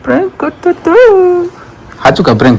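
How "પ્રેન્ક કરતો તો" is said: very high teasing falsetto; mock-cute falsetto tease; playful squeaky voice. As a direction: squeak it out in a silly tiny voice. Teasing singsong prank reveal.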